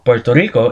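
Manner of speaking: Puerto Rico said as "Puelto Rico", r turned to l; Caribbean r to l. Shown as a demonstration. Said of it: "is pronounced as Puerto Rico in the local dialect".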